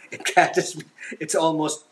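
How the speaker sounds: laughs it out, embarrassed; laughing. Real, not acted. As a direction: chuckle through the words. Laughing at his own nerves.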